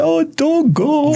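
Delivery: high, drawn-out imitated wail; mock tearful plea. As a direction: plead like a little sister, hammed-up and high. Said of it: "greeting and all that".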